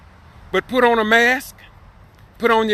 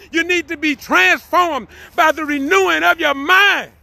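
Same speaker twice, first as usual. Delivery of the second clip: raised, intense preaching voice, pitched higher; fervent preaching; 8 semitones higher than usual. Acted. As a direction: proclaim it like a pulpit preacher warning the congregation. Preaching a scripture passage aloud.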